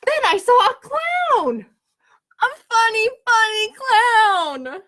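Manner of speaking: bursting with news of a funny clown; thrilled. Acted. Gerald tells Piggy about the clown, thrilled.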